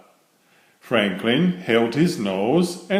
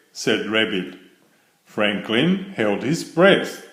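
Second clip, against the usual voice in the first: ordinary delivery, nothing marked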